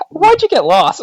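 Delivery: high, loud mock scolding; shrieky retold telling-off; mad mom. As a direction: imitate an angry mother scolding. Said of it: "my mom was so mad".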